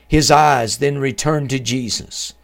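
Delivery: ordinary talk, nothing marked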